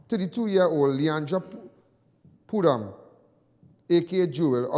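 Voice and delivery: loud, formal news report voice; news read. Acted. Reading the accident report to viewers, news read. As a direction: read it like a crime bulletin.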